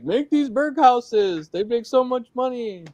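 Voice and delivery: high, slow, mock hype; hammed-up high YouTuber pitch. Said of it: "everyone on YouTube is like".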